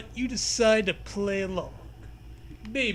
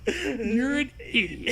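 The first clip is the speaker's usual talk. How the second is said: said through laughter; laughing helplessly while teasing back; 5 semitones higher, slower than usual. Real, not acted. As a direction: giggle your way through it.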